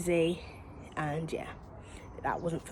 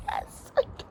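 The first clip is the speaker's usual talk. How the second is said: much higher, breathy sing-song mockery; mock chirpy reply. Mocking her own answer to the midwife, high and whispery. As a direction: play it as a silly sing-song yes. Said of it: "Well, I didn't say it like that".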